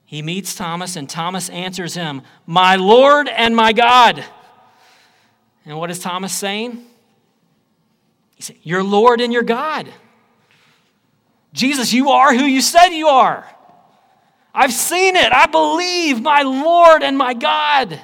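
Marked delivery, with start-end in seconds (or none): 2.5-4.2 Thomas's cry, slow and much louder; loud exclamation. Acted. Preacher voicing Thomas's cry, slow and loud. 8.6-18.0 climbing higher and louder into a shout; rising ecstatic cry. Acted. Preacher voicing Thomas, higher and louder to a shout.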